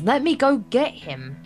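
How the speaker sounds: ordinary talk, nothing marked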